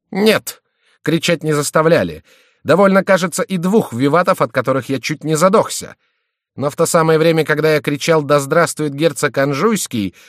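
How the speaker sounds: high, loud, heated; agitated, aggrieved tirade; indignant rant. A narrator's reading. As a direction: keep complaining at high pitch. Furious victim goes on ranting.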